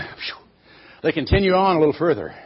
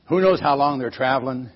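ordinary delivery, nothing marked

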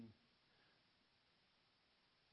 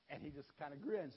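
normal talking voice

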